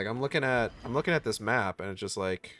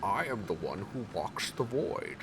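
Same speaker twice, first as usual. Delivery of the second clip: lightly voiced cosmic being, a touch deeper; vast deep cosmic voice; 4 dB quieter than usual. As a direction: intone it like a void entity. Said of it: "Why am I talking this voice?".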